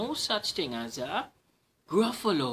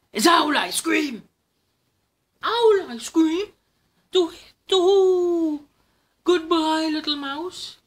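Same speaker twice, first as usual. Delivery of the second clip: high, loud panicked shriek; terrified owl; 13 semitones higher, 13 dB louder than usual. Acted. Owl panics at the mouse's words. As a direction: shriek in alarm and flee.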